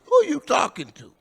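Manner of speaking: high, loud confrontational yell; retold angry challenge. [name] yelling at the stranger calling him daddy, high and loud. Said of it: "I said".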